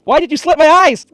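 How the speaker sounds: loud, high pained yell; imitated scream. Ichigo yelling at the man cutting his eyes, imitated scream. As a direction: scream the question like the wounded hero. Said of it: "he was screaming like a bitch".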